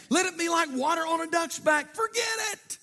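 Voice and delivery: high, ringing preaching cry; preaching shout. Real, not acted. Preacher urging forgiveness, high ringing shout. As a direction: drive it home to the church.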